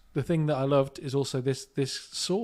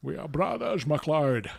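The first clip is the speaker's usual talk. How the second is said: Connery impression; 3 semitones higher, 4 dB louder than usual. Quoting the film to co-host, Connery impression. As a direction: declaim it as a mock Connery.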